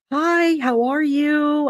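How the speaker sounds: high, drawn-out put-on hello; exaggeratedly polite retold greeting; sweet greeting. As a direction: greet them in a stretched, high voice. Retelling her polite greeting to neighbor.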